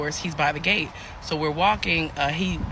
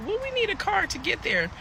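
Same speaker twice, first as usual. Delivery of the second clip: very high, squeaky puzzled voice; mock disbelief; 3 dB louder than usual. Traveler questioning her date about a short drive, mock disbelief.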